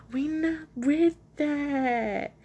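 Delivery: high, very slow drawn-out mock refusal; hammed-up, elongated catchphrase; exaggerated repeat. Real, not acted. Jokey drawn-out repeat of the line.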